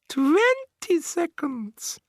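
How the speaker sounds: very slow, high, hissed witch whisper; hissed countdown. A narrator's reading. Grand High Witch counting down, hissed whisper. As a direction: hiss it with relish.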